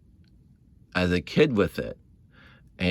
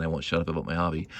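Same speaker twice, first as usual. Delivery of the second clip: ordinary talk, nothing marked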